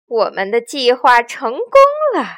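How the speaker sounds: odd gleeful voice. A narrator's reading. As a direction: gloat like a bandit chief. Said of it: "怪声笑道".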